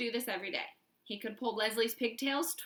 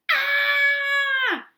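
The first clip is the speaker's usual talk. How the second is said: piercing loud high scream; 12 dB louder than usual. A narrator's reading. Leslie screaming in pain, loud and high. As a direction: shriek as if your hair is yanked. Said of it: "screamed Leslie".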